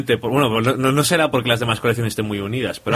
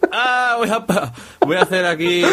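mock labouring mother; 9 semitones higher than usual. Pretending to give birth.

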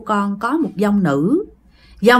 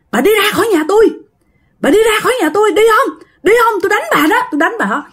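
angry threat; 12 semitones higher, much faster than usual. Retold or imitated. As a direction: scream at her to get out. Man threatens old woman with broom.

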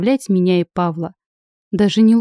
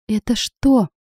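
ordinary delivery

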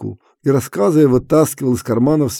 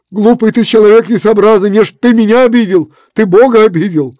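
higher, loud stern scolding; stern reproach; 6 semitones higher, 7 dB louder than usual. A narrator's reading. Efrem reproaches Kuzma, loud stern scolding.

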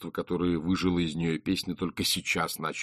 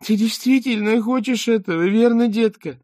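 put-on much higher, syrupy baby talk; Beril's high, sickly sweet sing-song; sugary cooing; 8 dB louder than usual. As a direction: coo it like talking to a toddler. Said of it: "засюсюкала самым слащавым тоном".

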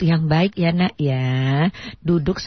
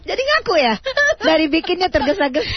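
teasing through laughter, higher; laughing tease; 14 semitones higher than usual. Real, not acted. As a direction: laugh while teasing her. Menggoda sambil tertawa. (menggoda sambil tertawa)

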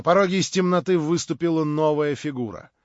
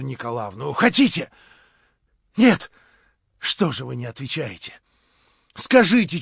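frantic pleading; 3 semitones higher, 6 dB louder than usual. A narrator's reading. Frantic bargaining, chasing Stavrogin.

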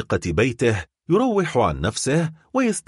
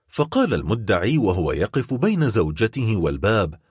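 usual speaking voice

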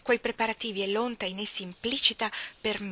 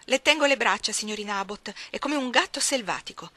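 straining servant; 2 semitones higher, 5 dB louder than usual. Acted. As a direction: hold her down and call for help. Bessie struggles holding Jane. (serva affannata che chiama)

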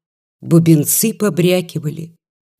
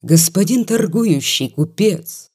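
ordinary delivery, nothing marked

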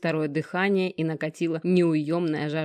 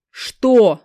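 loud, high defiant challenge; challenging; 5 semitones higher, 11 dB louder than usual. Acted. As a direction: throw it at them defiantly. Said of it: "с вызовом встретил Геныч".

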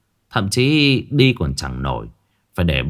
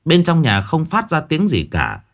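ordinary delivery, nothing marked

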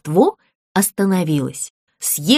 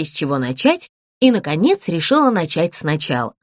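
usual speaking voice

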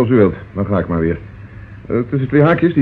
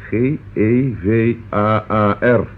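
slow, deliberate letter-by-letter spelling; 3 semitones lower, slower than usual. Acted. Inspector spelling the code to Mrs Oakes, slow and deliberate. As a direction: spell each letter out slowly for effect.

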